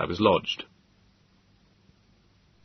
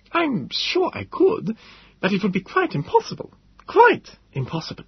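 shocked professor; 14 semitones higher than usual. A narrator's reading. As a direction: recoil at the idea. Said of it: "He looked shocked".

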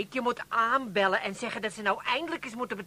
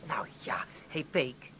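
whispered nudge, much softer; soft whispered call; 9 semitones lower, 7 dB quieter than usual. Acted. Wife softly waking dozing husband, whispered. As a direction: whisper to rouse him gently.

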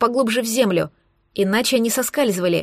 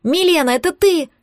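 higher, urgent questioning call; yelling; 6 semitones higher than usual. A narrator's reading. Girl asks the light below, calling out high. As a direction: call down to check who's there. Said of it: "снова заорала я".

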